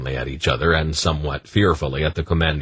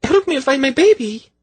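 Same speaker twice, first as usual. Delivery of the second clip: very high, louder plea for help; frantic cry; 4 dB louder than usual. A narrator's reading. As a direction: cry out desperately. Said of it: "she cried to them".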